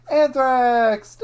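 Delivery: loud high payoff after a countdown; gleeful mock shout. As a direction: yell it like a prank reveal. Pretend teacher's countdown prank.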